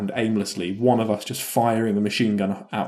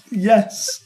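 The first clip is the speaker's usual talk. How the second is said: laughing; 12 semitones higher, 5 dB louder, much slower than usual. Real, not acted. Bursts out laughing at gamer tag.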